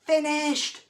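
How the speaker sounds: an eager pupil calling out; called out proudly. A narrator's reading. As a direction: announce it proudly. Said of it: "he called".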